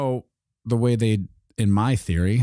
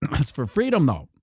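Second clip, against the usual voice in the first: high mock salesman; 7 semitones higher than usual. Official, high.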